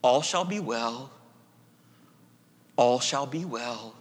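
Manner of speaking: soft, slow, reverent reading of the mystic's words; hushed quoting. Acted. Preacher quoting Julian of Norwich to the congregation, hushed and reverent. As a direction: let each line settle quietly. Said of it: "And I quote".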